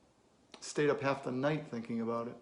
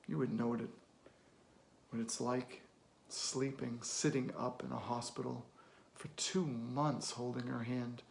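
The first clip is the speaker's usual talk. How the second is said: softer, heavy-hearted recollection; subdued grief; 2 semitones lower, 5 dB quieter than usual. Acted. Recalling a hospital vigil to a kid, subdued grief. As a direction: sink into a quiet, painful memory.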